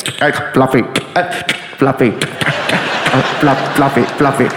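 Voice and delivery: low, crackly walkie-talkie voice; radio chatter. Retold or imitated. Name heard on all the radios, as crackly chatter. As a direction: imitate clipped radio calls between static. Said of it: "hearing on all the radios".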